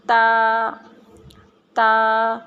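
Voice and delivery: تا with the tongue tip at the tooth roots, said loud and clear; loud careful تا for the class. Shown as a demonstration. Teacher modelling تا for children, loud and clear. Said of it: "زبان کی نوک ہے یہ دانتوں کی جڑوں".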